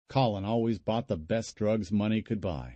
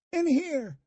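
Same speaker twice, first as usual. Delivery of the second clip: shouting. Acted. Answering her uncle.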